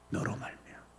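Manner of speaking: barely audible whisper; whispered echo. Real, not acted. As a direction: hush it to yourself. Whispered repetition. (속삭이듯)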